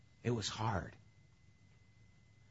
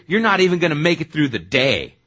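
loud, high put-down of a disciple; blunt slam; 5 semitones higher, 12 dB louder than usual. Acted. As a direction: slam him loudly in front of everyone. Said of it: "is that getting slammed or what".

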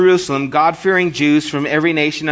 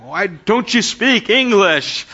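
mock-indignant, higher and drawn out; exasperated caller; 5 semitones higher, slower than usual. Frustrated caller to a foreign phone helper, mock exasperation. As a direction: stretch it out in comic exasperation.

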